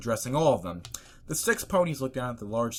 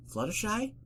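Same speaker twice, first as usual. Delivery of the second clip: Twilight voice; 12 semitones higher than usual. Acted.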